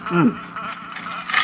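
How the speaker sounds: humming through a mouthful; cheeks stuffed full. Real, not acted. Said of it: "Sorry, my mouth is full of cookies".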